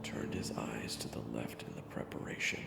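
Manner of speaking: whispered, tense storytelling; hushed narration. A narrator's reading. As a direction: draw them in with a breathless hush.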